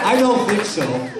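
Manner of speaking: high, loud no-nonsense teacher; stern refusal. Pretend. Teacher refuses the giggling student, sternly. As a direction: shut the student down.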